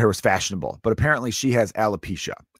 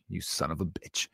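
low, very soft seething curse; imitated quiet anger; 7 semitones lower, 14 dB quieter than usual. Imitating a wife pissed at her husband, hushed anger. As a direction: curse him under your breath, mock pissed. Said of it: "she's pretty pissed".

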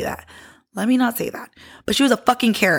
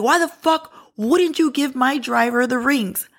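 higher, slow, drawn-out indignant demand; mock angry yell; 5 semitones higher, slower than usual. Uncle phones demanding his rings, imitated angry yell. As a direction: imitate an irate man yelling, without full volume. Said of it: "He is yelling".

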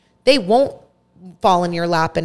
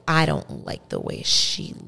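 slow, hushed, drawn-out contempt; mean woman's disdain; 5 dB quieter, much slower than usual. Retold or imitated. Mean resident telling the granddaughter why she dislikes her grandma, slow hushed disdain.

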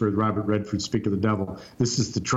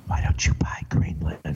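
whispered again, lower; 6 semitones lower than usual. Retold or imitated. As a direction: slip it into his ear in a hush. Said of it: "another general would leave".

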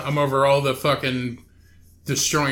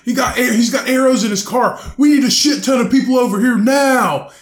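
imitating protesters, higher and louder; mock panicked protester; 8 semitones higher, 5 dB louder than usual. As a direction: fake alarm calling for backup. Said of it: "they're like".

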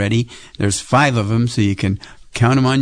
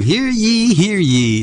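town crier; 9 semitones higher, slower than usual. Acted. Opening announcement of listening game.

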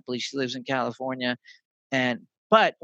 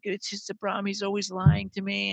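higher, soft mock-tearful complaint; retold crying; 5 semitones higher, 3 dB quieter than usual. Baby sister complains about lying boyfriend, mock crying. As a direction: lightly imitate an upset little sister. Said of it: "And she's crying".

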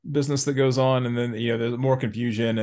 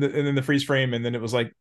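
normal talking voice

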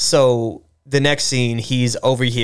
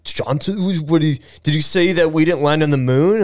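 higher, incredulous jab at a friend; lightly voiced scoffing coworker; poking fun at Dan's conspiracy talk, mock incredulous; mock skeptical buddy; 4 semitones higher than usual. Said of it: "They're like".

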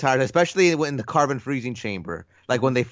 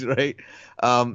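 ordinary talk

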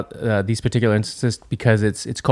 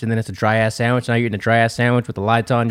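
normal talking voice

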